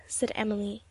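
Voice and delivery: ordinary delivery, nothing marked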